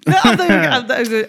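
cracking up while refusing to tell; laughing. Real, not acted. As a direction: squeal it out mid-laugh. Laughing off an embarrassing story.

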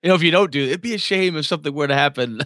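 sweetly menacing mobster; mock friendly threat. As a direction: lean in like a polite gangster. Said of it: "a suggestion of pain".

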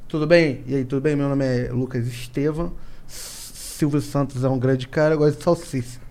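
quiet lisping demo, "salsicha" with sh for s; soft lisp, "Tudo bem?" with slushy s. Shown as a demonstration. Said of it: "se eu tento fazer língua presa, é assim".

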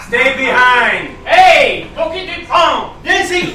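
slow, loud "stay behind"; barked order. Acted. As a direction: stop him at the line, firmly. Officer orders at airport line.

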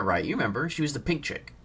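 ordinary talk, nothing marked

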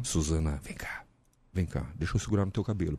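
soft, close, coaxing; mock seductive hush; seductive murmur. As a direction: lure her in quietly. Playful seduction bit.

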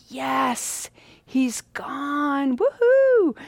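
rising to a loud high whoop; gleeful cheer. Retold or imitated. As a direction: cheer like the pest is finally gone. Monks celebrating the monk leaving.

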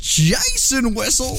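high, slow drawn-out name call; old-timey sportscaster. Shown as a demonstration. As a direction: announce him like a ballpark legend. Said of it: "Like I'm an old-timey baseball sportscaster".

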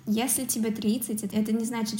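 normal talking voice

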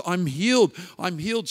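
loud, high cry of joy, retold; ecstatic. Preacher voices a healed woman leaping from her chair, ecstatic. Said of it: "She's, like, ecstatic".

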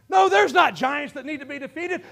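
ordinary talk

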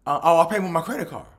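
hammed-up nervous stutter into a rushed excuse; nervous stammer. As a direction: stammer it out like you've been caught. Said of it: "she started stuttering".

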